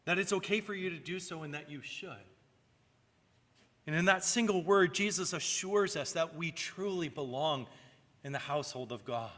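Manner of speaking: ordinary delivery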